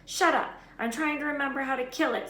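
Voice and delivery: loud high snap; snapping. A narrator's reading. Hermione snaps at Ron, loud sharp retort. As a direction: cut him off sharply.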